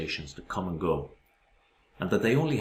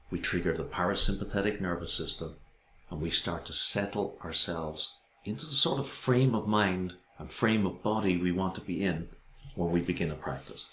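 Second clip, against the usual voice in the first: ordinary talk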